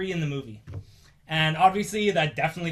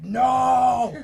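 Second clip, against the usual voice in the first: loud, raised shout of refusal; Hulk's refusal; 8 semitones higher, 8 dB louder than usual. Retold or imitated. Hulk refuses to come out for Banner, stubborn shout. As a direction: bellow it like a stubborn monster. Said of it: "Hulk just says".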